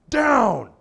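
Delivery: very high, loud "Down!"; imitating a trainer yelling at the dog; shouted command. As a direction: bark "Down!" to force it. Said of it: "So they go".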